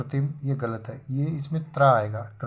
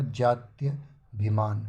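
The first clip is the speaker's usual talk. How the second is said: slow correct form; slower than usual. Shown as a demonstration. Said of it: "बड़ा का डंडा नहीं लेगा".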